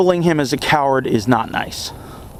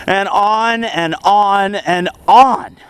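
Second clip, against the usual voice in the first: dragged out; 3 semitones higher, much slower than usual. Acted. Mocking endless self-pity.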